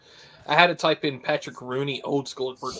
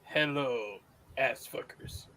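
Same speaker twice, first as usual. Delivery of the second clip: put-on Zeitgeist's Swiss accent; 2 semitones lower, 7 dB quieter, much slower than usual. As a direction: greet them in a Swiss accent. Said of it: "in his fucking Swiss accent".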